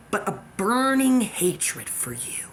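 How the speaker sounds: slow, breathy, hateful hiss; hissed hatred. Real, not acted. Threatening the stalker, a slow hateful whisper.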